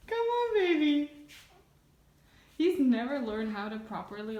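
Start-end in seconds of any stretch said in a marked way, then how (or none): 0.1-1.1 high coaxing pet voice. Real, not acted. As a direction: lure the cat gently. Coaxing cat.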